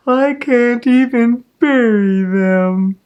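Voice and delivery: high, slow, loud wail; crying and carrying on. A narrator's reading. Little girl wailing over her dead mice. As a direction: wail it through tears. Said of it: "Ramona cried and carried on so".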